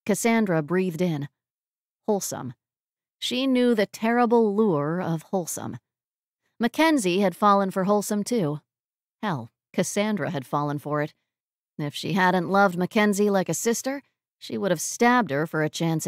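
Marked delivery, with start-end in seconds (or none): none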